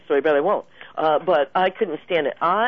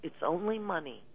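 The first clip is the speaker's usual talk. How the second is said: murmured, much softer, calming herself down; hushed private reminder; quiet self-reassurance; 12 dB quieter than usual. Retold or imitated. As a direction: soothe yourself under your breath. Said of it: "I look at it and I go".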